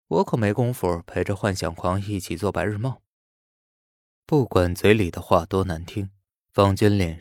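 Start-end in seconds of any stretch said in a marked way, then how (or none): none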